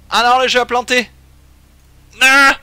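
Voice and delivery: loud, high cry of disbelief; dismayed outcry. Real, not acted. Streamer finds the game has crashed, loud high dismay. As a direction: cry out in real dismay, pitch jumping up.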